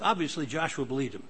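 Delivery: ordinary talk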